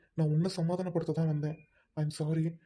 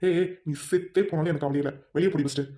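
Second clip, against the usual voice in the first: husband shouting his wife out of the room; angry yell; 2 semitones lower than usual. Acted. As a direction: throw her out with fury.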